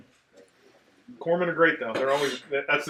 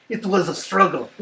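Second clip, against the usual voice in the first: higher take in a lightly imitated Portuguese accent; imitated foreign accent; 4 semitones higher, 3 dB louder than usual. Doc from maybe Portugal excusing the mess, accented imitation. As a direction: mimic Doc's Portuguese-sounding accent, pitched up. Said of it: "Portugal or some shit like that".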